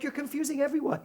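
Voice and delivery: loud, high mock complaint; exasperated scolding. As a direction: imitate an exasperated companion. Acted rebuke from exasperated companion.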